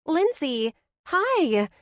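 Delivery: high, loud happy greeting; light up at hearing her friend; bright-voiced best friend squealing hello; excited Blair. A narrator's reading.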